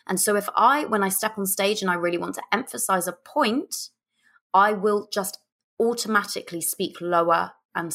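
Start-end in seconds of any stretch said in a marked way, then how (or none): none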